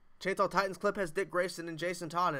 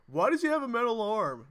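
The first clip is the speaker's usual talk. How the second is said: imitating girlfriend; 8 semitones higher, 8 dB louder than usual. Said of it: "What kind of questions would she ask".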